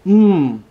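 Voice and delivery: high, loud hum of approval; savouring hum. Real, not acted. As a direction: hum with relish at the taste. Savouring food during a tasting.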